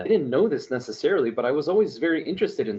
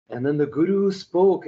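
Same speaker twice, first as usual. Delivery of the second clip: imitating a breathy, grand classical narrator, higher and louder; epic storyteller voice; 5 semitones higher, 4 dB louder than usual. Friend imitating the narrator of the old story tapes, grand and epic. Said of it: "he has a very classical voice".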